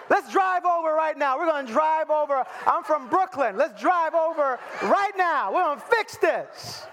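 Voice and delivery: high, hammed-up fired-up shout; rally for a fight, mock-furious and high; disproportionate outrage. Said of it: "in a disproportionate kind of a way".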